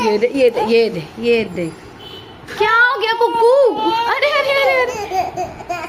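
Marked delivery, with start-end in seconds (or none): 2.6-5.9 high, drawn-out motherly concern; exaggerated cooing sympathy, pitched high. Real, not acted. Soothing crying toddler.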